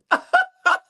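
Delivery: high, loud cackle; real high-pitched laugh at herself; burst of laughter. Real, not acted. Laughing at own story.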